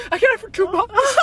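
high squealing laugh. Real, not acted. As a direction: laugh it out, thrilled. Laughing at cheap rare find.

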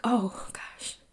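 breathy, frightened whisper; hushed little gasp of relief. Retold or imitated. To the police officer at her window, a frightened whisper.

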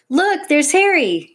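high, loud shout of discovery; excited shout. A narrator's reading. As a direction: shout it out, thrilled to spot him. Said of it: "shouted Tom".

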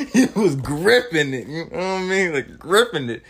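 chuckling through the words; laughing. Real, not acted. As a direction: laugh while you say it. Laughing at the scene.

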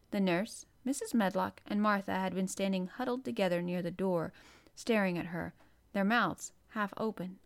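plain reading voice

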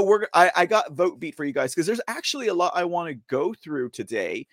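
normal talking voice

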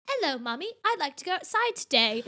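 child imitated with a British accent; fake British accent. Daughter greeting mom after watching Peppa Pig, fake British accent. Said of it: "why are you speaking with a fake accent".

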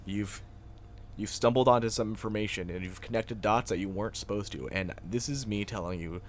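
low, soft menacing hush; ominous warning. Pretend. Cohost warning Alexa to back down, hushed menace. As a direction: warn her off like a shadowy agent.

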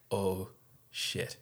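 ordinary talk, nothing marked

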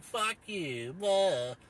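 a hint of a dismissive tough guy; lightly voiced scornful brush-off; mocking far-righter. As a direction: parody a right-winger brushing you off. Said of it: "far-right dickheads are like".

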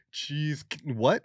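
high, loud slow-mo reaction; mock slow motion. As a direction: draw it out like a stunned slow-motion replay. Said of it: "It's like in slow-mo".

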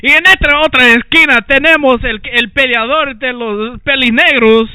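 lucha libre announcer. Pretend. Mock wrestling match introduction.

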